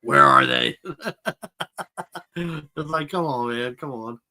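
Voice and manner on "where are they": screaming in a low tone; loud, very deep growled shout. Retold or imitated. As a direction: bellow it as an over-the-top gravelly vigilante. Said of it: "screaming with low tone".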